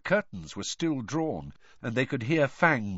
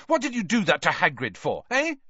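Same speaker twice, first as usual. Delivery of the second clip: higher-pitched, angry demand; furious; 7 semitones higher, 3 dB louder than usual. A narrator's reading. Harry confronts the reporter over Hagrid, furiously. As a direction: demand an answer, angrily.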